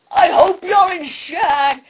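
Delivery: loud, high, drawn-out taunt; mock yell. Connery yelling at drivers whose cars flip, mock holler. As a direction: holler it as a movie-star impression. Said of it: "he yells out to people".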